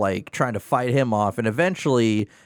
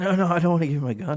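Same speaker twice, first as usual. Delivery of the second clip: mock whiny soldier; 7 semitones higher, much faster than usual. Mock-voicing a reluctant soldier.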